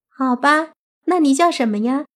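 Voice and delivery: put-on high, girlish questioning voice; curious fox-demon girl. Fox girl asks the zombie's name, high and curious. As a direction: ask sweetly as a little fox spirit.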